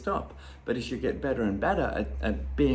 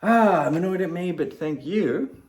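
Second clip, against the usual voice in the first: annoyed but grateful; 7 semitones higher, 7 dB louder than usual. Retold or imitated. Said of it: "the next step might be to, to go".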